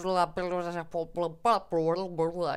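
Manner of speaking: garbled Swedish-sounding babble, syllables run together; muddled Swedish gibberish. Shown as a demonstration. Swede showing how muddled Swedes sound, mock Swedish gibberish. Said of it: "speak in a bit more muddled way".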